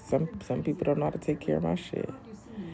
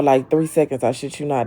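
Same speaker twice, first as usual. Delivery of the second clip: ordinary talk, nothing marked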